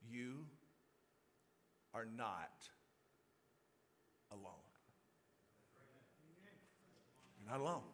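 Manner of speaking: soft, low, with long pauses between phrases; solemn reassurance. Real, not acted. Preacher looks all of you in the eye, solemn reassurance. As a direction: let each phrase land gently and low.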